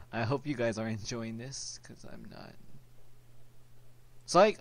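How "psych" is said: sudden loud high shout; loud yell. Real, not acted. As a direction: shout it out as a joke. Joking reversal, sudden yell.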